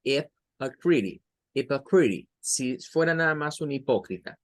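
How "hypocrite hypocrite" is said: hypocrite said slowly, the English way; English pronunciation. Shown as a demonstration. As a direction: model the English word hypocrite. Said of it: "en inglés es".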